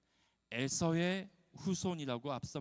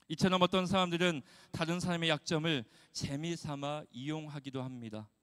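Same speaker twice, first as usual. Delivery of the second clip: ordinary delivery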